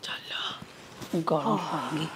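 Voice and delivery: a faint whispered go; sister-in-law gives in, whispering. Acted. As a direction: barely breathe the word. Reluctant, about to face mother.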